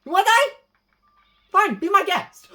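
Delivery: loud, high-pitched taunting challenge; mock threat. Player threatening a game enemy, loud mock threat.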